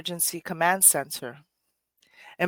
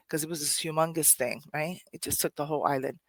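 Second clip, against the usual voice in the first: ordinary talk, nothing marked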